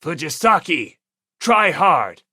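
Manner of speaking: loud, firm encouragement; rally a teammate; cheering. Acted. Ogami cheering Fujisaki on while baking, loud firm cheer. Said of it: "cheered Fujisaki on".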